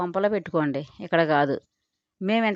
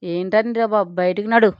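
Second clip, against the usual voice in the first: plain reading voice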